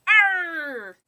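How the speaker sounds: loud, high pirate arr; pirate growl. Pretend. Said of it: "Talk Like a Pirate Day".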